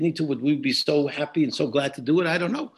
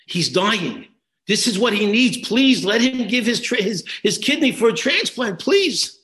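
desperate begging; 6 semitones higher, 6 dB louder than usual. Retold or imitated. Father begs through the door.

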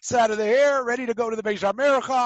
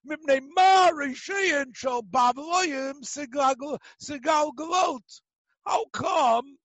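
mocking question; 6 semitones higher than usual. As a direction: drag out the insult like a heckler. Man asks provocative question.

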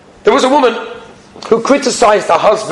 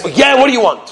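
mildly irritable, higher-pitched brush-off; a touch of grumpy impatience; bad-tempered; 6 semitones higher than usual. Customer brushing off the shopkeeper who asks a favor, bad-tempered. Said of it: "He's in a bad mood".

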